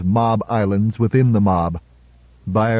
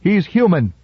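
high called-out cry; calling out; 8 semitones higher than usual. A narrator's reading. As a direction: Susan Calvin calling back to a reporter. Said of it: "called over her shoulder".

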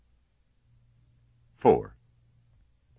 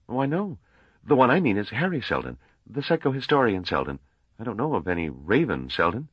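higher, lightly voiced young man, polite; Gale's voice; 7 semitones higher than usual. A narrator's reading. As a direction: lift the pitch for earnest young Gale. Narrator voices Gale.

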